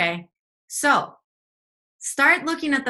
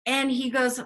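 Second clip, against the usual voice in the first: ordinary talk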